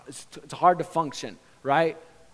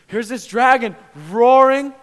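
high, loud, drawn-out preacher's shout; roaring; 9 semitones higher, 18 dB louder, slower than usual. Acted. Preacher sets up the dragon's attack, roaring. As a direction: roar it out slowly at full force.

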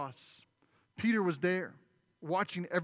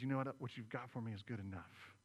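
tender acceptance; 4 semitones lower, 8 dB quieter than usual. Retold or imitated. Jesus accepting Peter.

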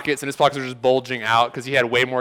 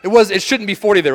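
whiny excuse; 6 semitones higher, 5 dB louder than usual. Retold or imitated. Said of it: "And they're like".

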